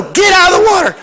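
high, fast shouted warning; urgent shout. Retold or imitated. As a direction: yell at them to get out. Warning girls about a shark.

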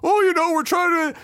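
high mock excuse-making voice; high-pitched imitation of hecklees answering back; imitated protesters. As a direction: lightly mimic people making excuses. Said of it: "people are looking up at him like".

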